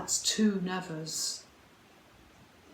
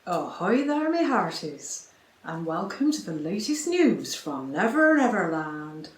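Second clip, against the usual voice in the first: hammed-up pirate accent, a bit louder; jolly mock pirate accent opening a news report; silly pirate accent; 2 semitones lower, 4 dB louder than usual. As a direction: greet the listeners like a panto pirate. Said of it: "Me talking in that silly pirate accent".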